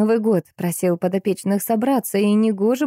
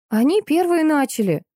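higher indignant outcry; exclaiming; 9 semitones higher than usual. A narrator's reading. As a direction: blurt it out, pitch up. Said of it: "тут же воскликнула мандаринка".

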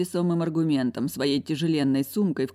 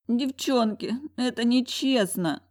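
higher, slow, tongue-tied protest; slurred tipsy mumble; 7 semitones higher, slower than usual. A narrator's reading. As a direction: protest drunkenly, words dragging. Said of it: "пробормотала заплетающимся языком". (пьяно, заплетающимся языком)

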